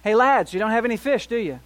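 loud teasing call, pitch up at the start; knowing call from shore. Retold or imitated. Jesus calling to the fishermen from the beach, loud and knowing. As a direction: call out like you already know the answer. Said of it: "It's almost as if he's saying".

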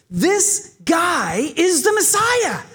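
slow, high and drawn out, in awe; crowd's excited proclamation. Acted. Preacher voicing the crowd that hails Jesus, awed and excited.

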